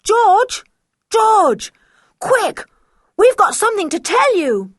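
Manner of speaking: loud, high urgent shouts; children calling their friend across the cliffs. Acted. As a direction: yell to get her attention from far off.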